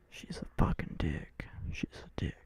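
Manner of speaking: hushed whisper under his breath; quietly cursing mom in a whisper; whispered insult. Real, not acted. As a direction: whisper it so mom won't hear.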